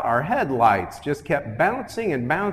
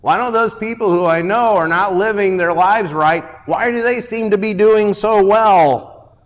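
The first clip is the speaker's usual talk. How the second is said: raised, louder complaint to God; aggrieved questioning; 8 semitones higher, 6 dB louder than usual. Acted. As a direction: preach it as a frustrated plea.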